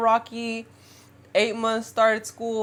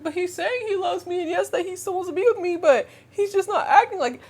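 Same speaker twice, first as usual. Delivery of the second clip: imitated higher-pitched protest; naive pleading; 10 semitones higher than usual. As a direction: plead like your deluded younger self. Voicing her naive younger self pleading.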